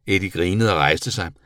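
ordinary delivery, nothing marked

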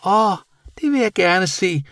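high, eager creature voice; excited bat calling out. A narrator's reading. Bat eager to see the paintings, high exclamation. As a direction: cry out high-pitched. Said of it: "råbte Senior".

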